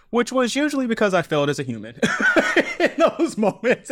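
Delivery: laughing it out, high and quick; rueful real laugh breaking through. Real, not acted. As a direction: laugh at yourself mid-sentence. Laughing at own failings.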